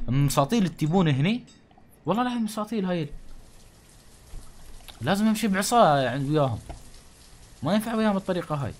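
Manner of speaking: loud, high exasperated rant. Real, not acted. Gamer scolding his dim villagers, loud and fed up. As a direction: scold them like you've lost patience.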